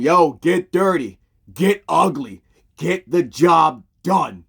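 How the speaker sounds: loud, slow, punched rallying cry; coach pep talk. Retold or imitated. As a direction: fire up the team phrase by phrase. Coach fires up the kids.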